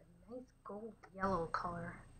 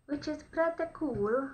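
higher, louder and slow, rising at the end; excited; 7 semitones higher, 9 dB louder, slower than usual. Real, not acted. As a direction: stretch it out with delight. Repeats the phrase with a different delivery.

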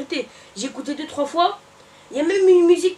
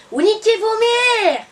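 loud, higher, very slow furious yell; angry insult; 6 semitones higher, 5 dB louder, much slower than usual. Real, not acted. Ranting at the video makers, a slow, loud, furious yell. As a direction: drag the insult out and hurl it.

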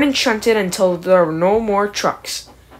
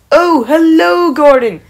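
high, slow cheery call; 8 semitones higher, 10 dB louder, slower than usual. Acted. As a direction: call across the harbour. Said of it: "called Bulstrode".

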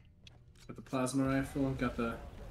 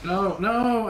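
dismayed shout; 7 semitones higher, 8 dB louder than usual. Real, not acted. Dying in game.

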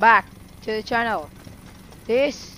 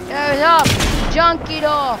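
louder, higher battle shout; louder, higher hyped firing shout; excited shout; 5 semitones higher, 7 dB louder than usual. Real, not acted. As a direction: hype every shot. Firing the junk cannon.

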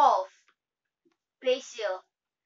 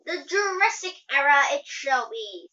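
lightly voiced attendant, projected loudly; 3 semitones higher, 8 dB louder than usual. A narrator's reading. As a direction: announce it like an attendant. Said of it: "sounding like, like a attendant".

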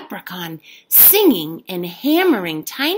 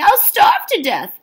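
wailing; 10 semitones higher, 4 dB louder than usual. A narrator's reading. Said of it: "wailed Jamie".

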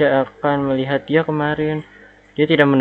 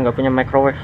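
ordinary talk

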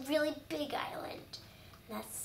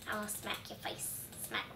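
whispered threat; 4 semitones lower, 9 dB quieter than usual. Acted. Quiet threat to a mob.

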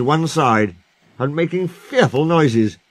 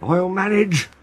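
high, strained gasping effort; labored, puffed-out old engine; wheezing; 9 semitones higher, slower than usual. Acted. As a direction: force it out between wheezy breaths. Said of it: "He wheezed".

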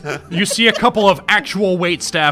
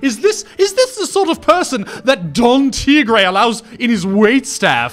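high, loud, scandalized society lady; snooty wedding guest; 7 semitones higher, 5 dB louder than usual. Acted. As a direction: gasp in shock at the waiter's outfit.

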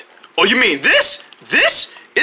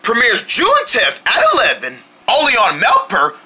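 ordinary talk, nothing marked

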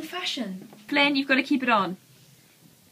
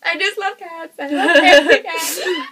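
laughing tease; 2 semitones higher, 11 dB louder than usual. Retold or imitated. Mocks friend's cat love, laughing.

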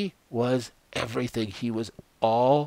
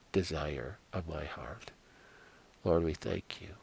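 soft, hushed prayer voice; preacher closes the sermon with a soft, hushed prayer; closing prayer; 3 semitones lower, 3 dB quieter than usual. Acted. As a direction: pray it quietly, fading to amen.

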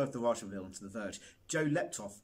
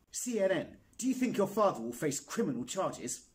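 loud, high, pushy reporter shouting a question; 8 semitones higher, 4 dB louder than usual. Acted. Reporter shouts at the boy from the press pack, loud and pushy. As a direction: fire the question over the crowd. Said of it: "shout questions at him".

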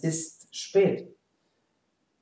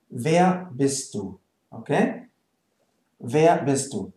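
ordinary talk, nothing marked

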